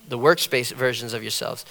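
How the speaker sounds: ordinary delivery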